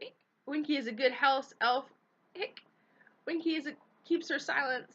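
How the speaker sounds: rising tipsy fury between hiccups; drunk rant. A narrator's reading. Drunk elf ranting.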